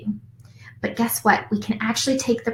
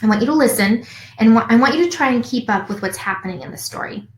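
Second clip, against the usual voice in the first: ordinary delivery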